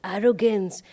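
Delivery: ordinary talk, nothing marked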